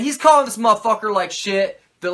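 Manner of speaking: usual speaking voice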